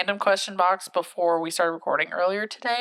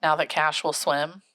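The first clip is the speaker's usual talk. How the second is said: usual speaking voice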